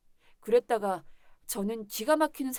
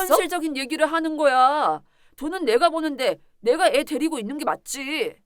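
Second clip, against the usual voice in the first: loud, higher, forceful arguing back; heated insistence; 3 semitones higher, 8 dB louder than usual. Acted. Husband claims custody from wife, insisting heatedly. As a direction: overrule her loudly.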